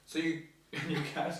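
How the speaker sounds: slow, laughing question. Real, not acted.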